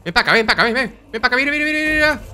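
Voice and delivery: teasing the enemy to come closer; taunting call. Real, not acted. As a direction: beckon the enemy, climbing in pitch. Taunting enemies in the game.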